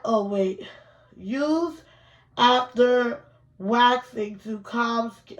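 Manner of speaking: ordinary talk, nothing marked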